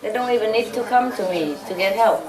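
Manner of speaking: usual speaking voice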